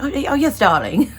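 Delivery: a light, high British accent for the joke; mock-posh British accent. Shown as a demonstration. British accent, showing viewers her fun put-on voice. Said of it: "break out in a British accent".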